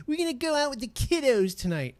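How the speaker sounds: high, quick chirpy parent impression; mocking kiddos parent. Mocking parents who call kids kiddos, high and chirpy. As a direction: sneer at a gushing parent, chirping fast. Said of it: "people that call their kids kiddos".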